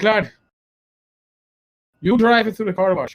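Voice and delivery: captain's lightly imitated Irish brogue. Captain sending his officer to the car wash, Irish brogue. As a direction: order it in a light Irish lilt. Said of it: "my captain with his Irish brogue".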